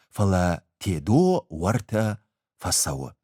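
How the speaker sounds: ordinary delivery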